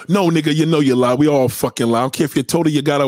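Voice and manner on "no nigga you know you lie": ordinary talk, nothing marked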